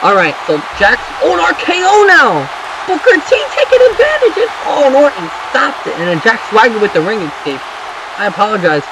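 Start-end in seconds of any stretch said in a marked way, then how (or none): 1.2-2.4 high-pitched burst of surprise; excited shout. Real, not acted. Commentator reacts to an RKO, shouting excitedly. Sudden big move in match. 2.9-5.9 high, frantic running call; excited play-by-play. Real, not acted. Commentator follows Booker T's attack, very excited.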